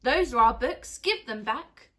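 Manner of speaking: loud indignant yell. Acted. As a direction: yell it like angry kids. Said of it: "the children yelled".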